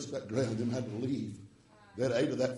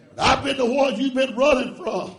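shouted, high preaching with long stretched words; full-force preaching; 11 semitones higher, 11 dB louder than usual. Acted. Preacher shouting salvation to his congregation, full-force preaching. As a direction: preach it hard to the church.

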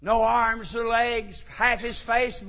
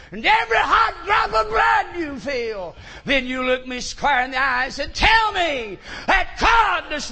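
rising to a shouted, high defiance of the devil; defiant shout; 4 semitones higher, 5 dB louder than usual. Acted. Young man shouting down the devil at Calvary, defiant shout. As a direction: hurl it at the devil, voice climbing. Preacher climaxes quoted defiance.

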